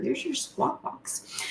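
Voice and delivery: a light whispered take on her husband; imitating husband. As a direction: mimic the husband's dry whispered jab. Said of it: "My husband calls them the squawk box".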